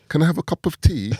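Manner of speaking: high-pitched mock English accent; exaggerated English tea-time voice, high and teasing. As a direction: put on a caricature English accent, pitched right up. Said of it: "You always sound like".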